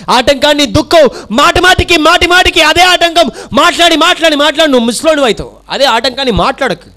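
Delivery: shouted, high-pitched sermon lines; full-force preaching. Acted. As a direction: preach it out with fire to the crowd. Preaching climax. (పూర్తి ఉత్సాహంతో బోధన)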